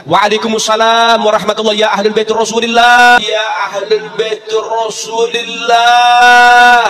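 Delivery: slow, loud, drawn-out call; saint's call from the grave. Acted. Dead saint greets the visiting habib with a long loud call.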